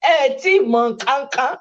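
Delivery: said through a laugh. Real, not acted.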